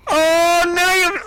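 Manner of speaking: high, loud oh no; startled loud oh no; dismayed cry. Real, not acted. Dismay at play.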